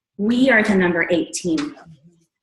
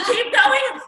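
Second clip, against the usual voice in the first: excited shout; 14 semitones higher than usual. Retold or imitated. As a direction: cheer the countdown onward. Urging the countdown on.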